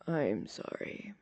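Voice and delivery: slow unvoiced whisper; hurt man whispering sorry. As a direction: breathe out a weak apology. Injured Zuko apologizing.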